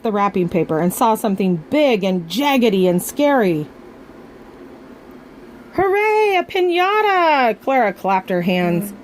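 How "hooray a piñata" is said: high, bright burst of joy; excited cheer. Acted. Clara thanks Samson for the piñata, cheering high. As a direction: cheer like it's the best gift.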